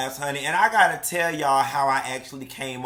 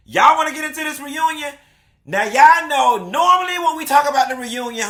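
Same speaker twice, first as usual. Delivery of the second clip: loud, high, fast gossip; sassy show host energy; hype gossip host; 6 semitones higher, 4 dB louder, faster than usual. Acted. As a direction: spill the tea loudly to the fans.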